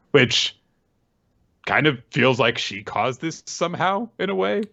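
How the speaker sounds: usual speaking voice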